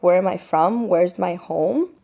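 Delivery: normal talking voice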